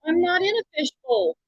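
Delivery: muffled, hollow mic sound; inside a fishbowl. Real, not acted. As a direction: voice boxed in like a fishbowl. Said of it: "You sound like you're in a fishbowl".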